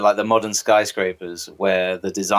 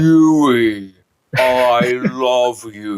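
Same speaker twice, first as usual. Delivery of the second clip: Starlight voice; 3 semitones higher, 4 dB louder, much slower than usual. Acted. Voicing Starlight to Huey.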